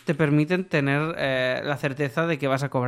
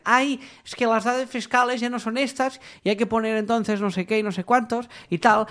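higher put-on voice of a client making excuses; whiny client excuse; 7 semitones higher than usual. Client giving the freelancer a tax-data excuse, higher whiny voice. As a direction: sigh out the client's fussy excuse. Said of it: "te dicen".

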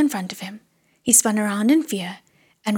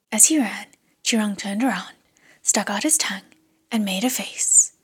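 usual speaking voice

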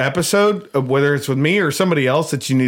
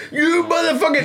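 mock angry. Example of insulting the friend's girlfriend, mock angry. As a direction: wind up a hammed-up insult.

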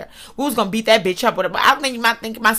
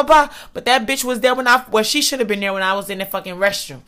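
ordinary delivery

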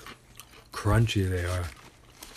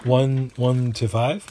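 loud, upbeat host announcement; announcer voice; 2 semitones higher, 5 dB louder than usual. Acted. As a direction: announce the next segment with energy. Host announces the ratings segment.

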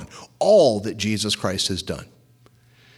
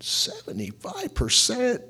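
shocked disbelief; 12 semitones higher, 3 dB quieter, slower than usual. Retold or imitated. Shocked reaction to quote.

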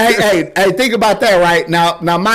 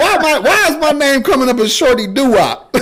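high, laughing, half-crying complaint; mock whine of disbelief; whiny disbelief; 7 semitones higher than usual. Acting out friend's reaction.

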